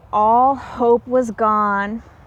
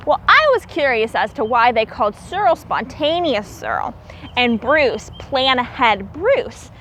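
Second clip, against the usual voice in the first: ordinary delivery, nothing marked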